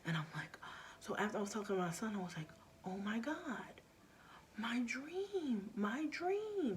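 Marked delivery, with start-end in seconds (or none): 2.9-6.8 gasping realization, rising high; a mother realizing her dream was a warning; sudden realization. Retold or imitated.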